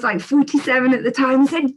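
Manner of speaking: ordinary talk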